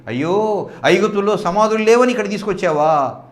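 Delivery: the people wail at Moses for bringing them; complaining crowd. Acted.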